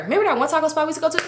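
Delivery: quick, high re-enactment of friend; imitating friend. Friend nudging her to play along, rushed imitation. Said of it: "this is her".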